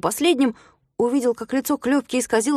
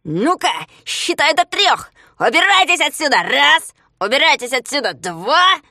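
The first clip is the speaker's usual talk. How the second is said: loud, raised-pitch ultimatum; threatening countdown; 5 semitones higher, 5 dB louder than usual. A narrator's reading. Ригль threatens, counting to three. (грозный громкий отсчёт)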